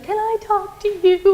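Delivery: put-on a little girl asking the filmmaker for a word, high child voice. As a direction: ask it like a shy six-year-old. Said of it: "And she's like".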